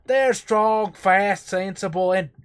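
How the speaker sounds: put-on a loud, high accent that slides toward American; a Cockney attempt that drifts stateside; Cockney slipping into Southern American. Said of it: "you're going so American".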